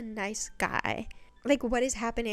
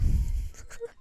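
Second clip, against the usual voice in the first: short laugh. Real, not acted. Laugh alone.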